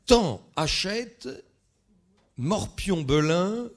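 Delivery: high, loud, slow spaced-out words; emphatic quizmaster call-out; declaimed quiz clues. Shown as a demonstration. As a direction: announce each clue separately to the room. Calls out riddle clues to audience.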